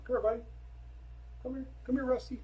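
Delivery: high-pitched call to a pet; high, friendly beckoning; coaxing dog call. Real, not acted. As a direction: coax the dog over in a high voice. Calling the other dog over.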